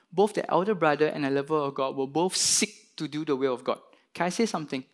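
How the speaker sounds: ordinary talk, nothing marked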